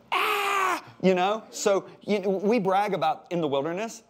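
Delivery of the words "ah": a sudden high comic shriek of despair; anguished scream. Retold or imitated. Israelite shrieking at being served bread again. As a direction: shriek like you can't face more bread.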